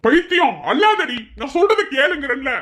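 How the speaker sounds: loud, high-pitched pleading; exasperated shout. Acted. Husband begging crying wife to listen, exasperated shout.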